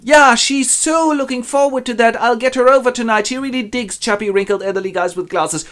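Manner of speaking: high-pitched eager sales pitch; gushing matchmaker. Acted. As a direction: sell the match, starting loud and easing off. Matchmaker flatters old suitor.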